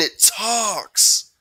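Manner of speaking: high, loud jeering scream; loud mocking shout; screamed taunt. A narrator's reading. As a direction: scream it out, well above normal pitch. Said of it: "screamed Eddie".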